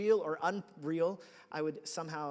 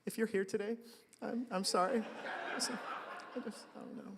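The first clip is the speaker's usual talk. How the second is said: a chuckling apology; laughing apology; 5 semitones higher than usual. Real, not acted. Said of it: "apologizing to the guy, laughing".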